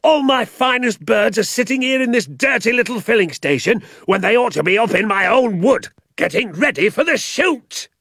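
irate landowner shouting at the sergeant, high furious yell; angry shout. A narrator's reading. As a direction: spew it out like hot lava. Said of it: "shouted Mister Hazel".

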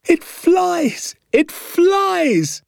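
put-on very high, gleeful child's cries; boy jumping for joy; jubilant shout. A narrator's reading. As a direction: shout it over and over in delight, pitched sky-high. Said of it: "I shouted, clapping my hands and jumping about".

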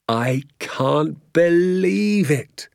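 high, drawn-out, marvelling cry; elated disbelief. A narrator's reading. Father to son after the night's adventure, elated disbelief. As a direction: stretch the words out in joyful disbelief.

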